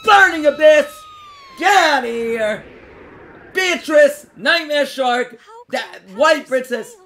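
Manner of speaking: loud, hyped attack calls; excited callouts. Acted. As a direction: call the attacks like an anime duel. Swinging for game callout.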